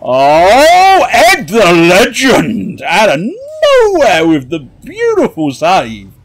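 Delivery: high, loud sportscaster cry; excited commentary. Real, not acted. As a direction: hail the save like a commentator.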